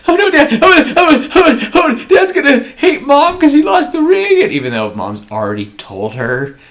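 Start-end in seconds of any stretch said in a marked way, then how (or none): 0.1-4.4 put-on very high, loud panicked child; panicked kid. Liam panicking that Dad will find the ring lost, high frantic kid voice. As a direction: babble it like a scared little boy. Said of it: "and he's like".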